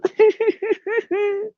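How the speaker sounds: high-pitched giggling fit; real laugh. Real, not acted. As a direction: burst out laughing at your own singing. Laughing at own singing.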